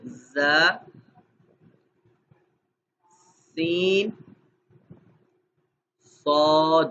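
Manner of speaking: "زا" "سین" "صاد" said one by one, clearly; spaced clear letters for the class; letter sounds for repetition. Shown as a demonstration. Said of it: "سبھی لوگ پڑھیے گا".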